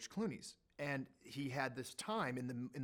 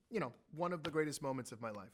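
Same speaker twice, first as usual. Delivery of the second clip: usual speaking voice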